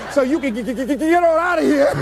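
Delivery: customer's line stuttered through laughter; laughing stutter. Retold or imitated. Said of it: "she stutters".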